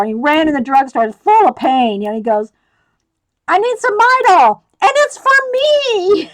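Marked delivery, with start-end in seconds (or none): 3.5-6.3 much higher and louder, slowing at the end; pleading, squeaky and urgent; desperate plea. Retold or imitated. Man buying Midol at drugstore.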